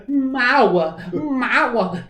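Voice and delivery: high, loud drawn-out [name] voice on Mawa; [name] impression. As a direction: belt Mawa the way a fan imitates [name]. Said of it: "You have to say it like this".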